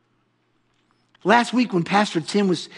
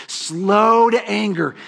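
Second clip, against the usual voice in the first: slow, drawn out; 3 semitones higher, much slower than usual. Shown as a demonstration. Preacher savoring slow to anger for the congregation, drawn out. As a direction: let each word of slow to anger linger.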